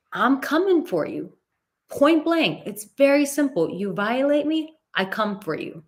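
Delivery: real fired-up conviction; fired-up emphasis. Real, not acted. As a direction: hammer the point home. Emphatic personal rule stated.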